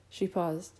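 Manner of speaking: usual speaking voice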